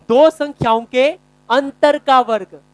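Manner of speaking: loud slow dictation. Shown as a demonstration. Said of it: "dictating formula to class".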